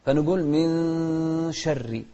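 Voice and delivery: من شر with the nun of من hidden, nasal glide into شر. Shown as a demonstration. Teacher showing the child how to hide the nun, slow and careful. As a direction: say من شر as "mi-sharri", nun hidden, slowly. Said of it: "يعني نخفي النون".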